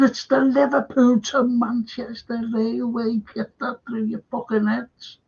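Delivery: imitating his own row with the jock; retold telling-off. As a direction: hammer it home like you're scolding him again. Retelling telling off the Scottish man.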